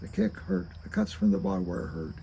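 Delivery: usual speaking voice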